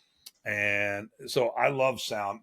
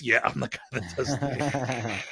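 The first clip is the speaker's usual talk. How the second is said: slow, dry confirmation; his own voice echoing the fan, slow and dry; deadpan reply; 3 dB quieter, slower than usual. Retold or imitated. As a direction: answer flatly, unimpressed with yourself.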